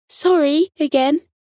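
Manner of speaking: high, lilting apology; apologetic nightingale. A narrator's reading. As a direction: say sorry in a high songbird voice. Nightingale apologises once more.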